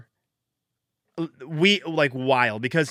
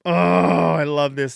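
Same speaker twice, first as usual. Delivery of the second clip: slow, louder savoring; real excitement; 3 semitones higher, 4 dB louder, slower than usual. Real, not acted. Podcaster savoring the lead, excited. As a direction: relish every word. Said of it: "way too much excitement".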